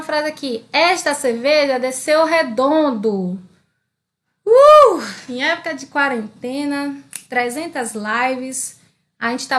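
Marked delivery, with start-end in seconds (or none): 4.4-5.0 high, loud "uh"; excited whoop. Real, not acted. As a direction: let out a sudden high-pitched whoop. Whoop after beer example.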